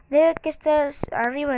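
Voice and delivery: normal talking voice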